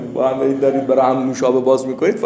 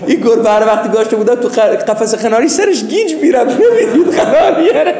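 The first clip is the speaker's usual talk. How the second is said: laughing punchline; 7 semitones higher, 9 dB louder than usual. Real, not acted. Punchline told through laughter.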